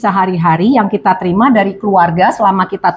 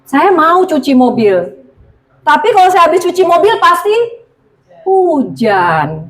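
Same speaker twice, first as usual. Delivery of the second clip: doubtful car washer; 6 semitones higher than usual. Retold or imitated.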